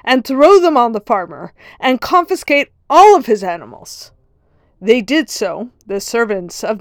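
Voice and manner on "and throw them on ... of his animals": loud, high angry command; furious order. Retold or imitated. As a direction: bark the order like an enraged lord. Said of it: "The nobleman was furious".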